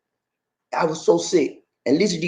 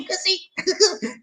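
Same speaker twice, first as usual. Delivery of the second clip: same words far higher, put-on; squeaky repeat; 5 dB quieter than usual. Mocking his own greeting, high squeaky voice.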